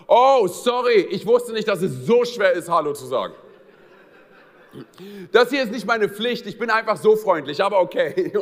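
hammed-up snarky reply to someone who won't greet back; sarcastic rant. A man snapping at a passer-by who didn't greet him, loud and sarcastic. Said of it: "der stehen bleibt und sagt".

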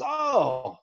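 high retold "oh" of recognition; delighted recognition. Coach recognizes him, retold.

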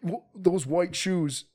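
slow, drawn-out mock homeless guy voice. Storyteller voicing the homeless guy who mocks his shoes, drawn out. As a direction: drag the words out like the scraggly stranger.

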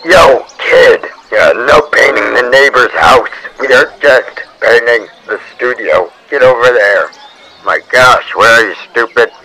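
megaphone shout. Acted. Joe Man yelling through megaphone.

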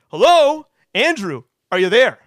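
the voice calls out again, demanding an answer; insistent inner voice. Retold or imitated. Voice in his head calls Andrew, loud and insistent. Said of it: "And it's like".

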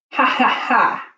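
slow hammed-up triumphant laugh; mocking laugh. A narrator's reading. Mister Dart laughs at the arrested thieves, slow mock laugh. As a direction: gloat over the caught thieves.

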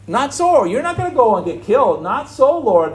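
high, loud protest, fast; Peter protesting. Retold or imitated. Peter pleading with the Lord, loud high protest. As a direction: Peter refusing to let the Lord be killed. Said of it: "Peter said".

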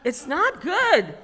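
high, loud and slow verdict; emphatic punchline. Real, not acted. Warning the audience about a horse up close, emphatic. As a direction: land it loudly, stretching each word.

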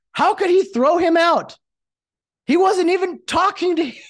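loud, high mock indignation; hammed-up outraged shouting; outraged broadcasters. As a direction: cry out in exaggerated disbelief. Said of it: "what do you hear from the broadcasters?".

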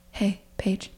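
very soft, imitating the date; tentative murmur. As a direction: softly try to get her attention in bed. Date softly seeks attention in bed.

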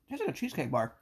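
talking with a mouthful; words round a bite of food; chewing while talking. Real, not acted. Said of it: "you can hear us chewing".

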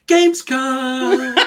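slow, high, drawn-out hype announcement; announcer voice. Retold or imitated. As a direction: stretch it out like a showcase host. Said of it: "exactly what they said when they introduced it".

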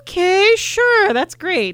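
high, bright, sarcastic okay sure; sarcastic cheer. Retold or imitated. Friend mocking the early pickup with sarcastic high cheer.

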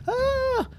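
very high, thin cry of panic; fleeing scream. Acted. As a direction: shriek while escaping. Mimis flee into the woods.

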